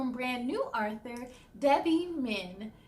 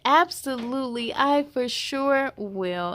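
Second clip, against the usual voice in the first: ordinary delivery, nothing marked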